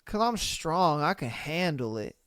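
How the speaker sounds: low, soft, dull mock of the cheater; mock dopey voice. Streamer mocking a boyfriend's excuse, low dull voice. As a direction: drop low and quiet like a dim guy.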